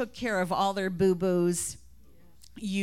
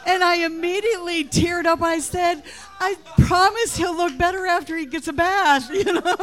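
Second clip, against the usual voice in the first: real laughter, higher and louder; laughing; 12 semitones higher, 6 dB louder than usual. Real, not acted. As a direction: laugh through the words. Laughing through the story.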